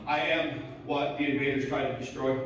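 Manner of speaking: ordinary delivery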